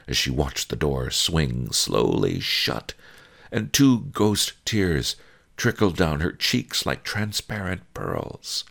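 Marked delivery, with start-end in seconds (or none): none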